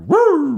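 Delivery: high loud mock police siren whoop; imitated police siren. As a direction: whoop like a cop car pulling up.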